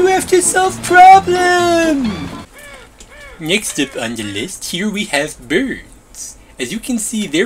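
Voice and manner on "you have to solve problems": high, loud exasperated telling-off; shrill scolding. Acted. Bee voice scolding the builders for playing with boats. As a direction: scold them like naughty kids.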